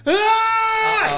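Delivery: sudden high piercing scream from another room; a short shrill "Ah!" like a kid in the dentist chair; retold scream. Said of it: "screaming bloody murder".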